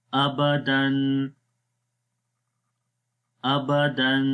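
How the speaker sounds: slow spaced teacher recitation with long pauses; Qaida recitation drill. Shown as a demonstration. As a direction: sound out each spelling for children to repeat. (بچوں کو دہرانے کے لیے آہستہ ہجے)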